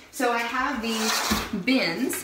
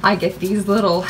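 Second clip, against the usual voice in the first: normal talking voice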